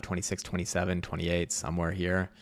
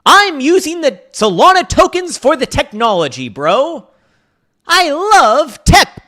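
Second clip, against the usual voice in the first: much higher and louder hammed-up squeal; mock tech bro squeal; 11 semitones higher, 11 dB louder than usual. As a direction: shriek like an overexcited crypto bro. Mocking Solana holders' hype.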